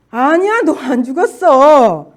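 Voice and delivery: loud, drawn-out devil's contradiction; mocking Satan. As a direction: taunt back as the devil, slowly. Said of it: "나를 조롱하는 사단의 소리가".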